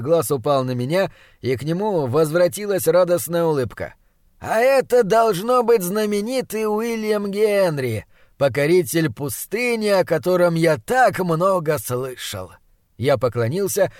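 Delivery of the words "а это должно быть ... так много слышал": slow, drawn-out delighted greeting, starting high; effusive old Austrian host. Acted. Austrian host meets his friend's young assistant, effusively. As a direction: greet the boy grandly, savouring each word.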